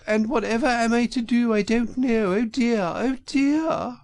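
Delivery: high falsetto, weepy and wailing; high whimpering old woman, slowing on oh dear; tearful washerwoman. A narrator's reading. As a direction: Toad sobbing out a sorry tale in disguise. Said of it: "crying afresh".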